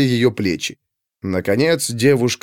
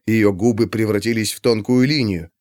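usual speaking voice

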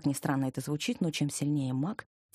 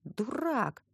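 offended; 5 semitones higher than usual. Acted. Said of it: "обиделась я".

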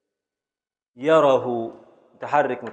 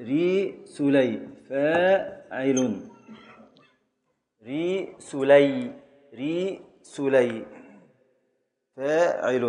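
slow scansion; much slower than usual. Shown as a demonstration. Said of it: "syllable-by-syllable scansion".

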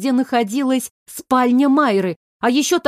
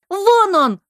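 high, thrilled cry; excited shout; 10 semitones higher, 3 dB louder than usual. A narrator's reading. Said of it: "азартно крикнула Оля".

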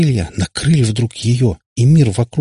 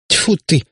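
high, spat-out тьфу ты; spitting tfu; 9 semitones higher than usual. A narrator's reading. Katipap spits in annoyance at the question. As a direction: spit the word тьфу out. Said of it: "опять сплюнул Катипап".

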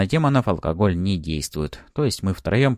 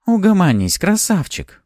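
plain reading voice

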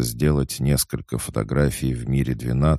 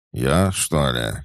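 slow, drowsy mumble; sleepy child; 2 semitones higher, slower than usual. Acted. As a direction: sound half asleep, slowly. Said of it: "сонно пробормотал Боря".

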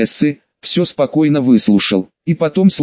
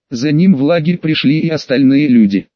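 ordinary delivery, nothing marked

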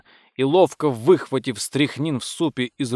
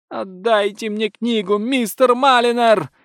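high-pitched imploring demand, swelling louder; high, insistent lady's wail; desperate plea; 10 semitones higher, 5 dB louder than usual. A narrator's reading. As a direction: beg for the book through a locked door. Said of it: "умоляющий голос леди Бассет".

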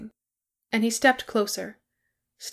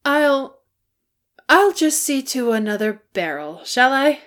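high, loud stammered excuse; flustered; 6 semitones higher, 8 dB louder than usual. Acted. Hobbit excusing himself to fetch another barrel, flustered. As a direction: blurt an excuse to get away.

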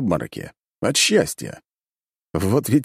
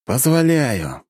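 resigned sigh; 6 semitones higher, much slower than usual. A narrator's reading. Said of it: "вздохнул я".

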